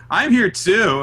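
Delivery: high, loud mock protest; indignant plea for notice. Host acts out the ignored teammate butting in, mock indignant. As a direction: pipe up as the overlooked one. Acting Noah wanting notice.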